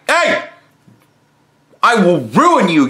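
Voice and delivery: sudden sharp call, then a hard warning; acted threat. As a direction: shout him down, then threaten him. Confronting a harasser, acted threat.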